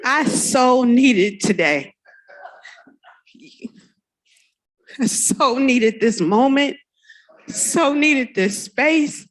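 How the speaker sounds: slow, sincere, savouring each phrase; heartfelt and moved. Real, not acted. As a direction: let every line sink in, full of gratitude. Moved speaker opens her speech.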